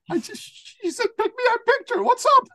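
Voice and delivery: very high squeaky protest; hammed-up incredulous squeal; comic bafflement. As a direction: act out helpless confusion, pitch way up. Replays baffled reaction to crying woman.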